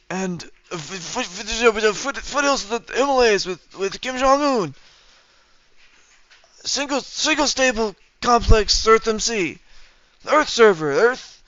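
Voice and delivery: high, slow, rambling mock old-man voice; a failing Biden ramble that loses the thread; Biden impression. To chat, a floundering Biden impression, high and slow. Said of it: "I can't do Joe Biden".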